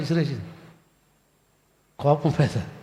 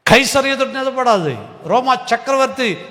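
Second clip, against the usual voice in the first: కైసరు ఎదుట నిలబడాలి cried out higher and louder; proclaimed loudly; 7 semitones higher, 3 dB louder than usual. Shown as a demonstration. Preacher proclaiming Paul's trial to the congregation, loud and high.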